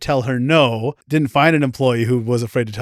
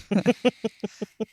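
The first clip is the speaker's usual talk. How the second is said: high giggle; 7 semitones higher, 5 dB quieter than usual. Real, not acted. Host laughs at crispy score.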